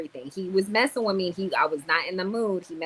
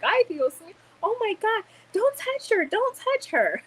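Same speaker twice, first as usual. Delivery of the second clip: much higher, frantic mom pleading; shrill panicked plea, much higher; panicked Dominican mom. Retold or imitated. Dominican mom watching the fight, much higher panicked voice. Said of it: "My Dominican mom was standing there, too, like".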